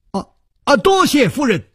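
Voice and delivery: light up with sudden understanding; brightening thanks. A narrator's reading.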